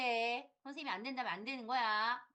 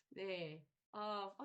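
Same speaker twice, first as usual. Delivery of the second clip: ordinary talk, nothing marked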